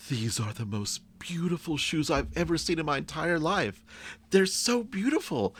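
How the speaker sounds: high-pitched gushing customer; gushing wife. Acted. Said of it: "the wife is like".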